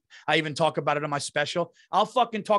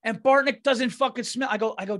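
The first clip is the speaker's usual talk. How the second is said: ordinary talk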